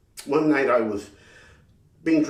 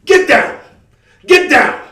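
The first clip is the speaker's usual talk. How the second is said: cop yelling; 12 semitones higher, 13 dB louder than usual. Retold or imitated. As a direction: get down barked, much higher and louder.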